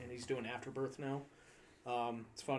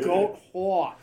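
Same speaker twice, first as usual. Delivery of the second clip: lightly voiced New York accent, louder; 5 semitones higher, 13 dB louder than usual. Shown as a demonstration. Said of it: "That's funny to say with my New York accent".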